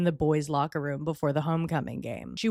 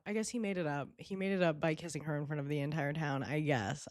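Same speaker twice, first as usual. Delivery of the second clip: much softer, grudging aside; grudging concession; 3 semitones lower, 8 dB quieter, faster than usual. Real, not acted. Commentator conceding he made it up, grudging. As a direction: concede it under your breath.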